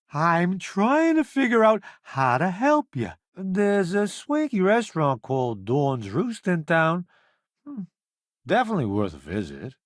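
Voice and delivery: ordinary talk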